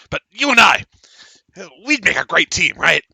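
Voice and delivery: pitched high and loud, wheedling; halfling buttering up his new friend; scheming halfling. Acted. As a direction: sell the partnership, voice up high. Halfling schmoozes the player.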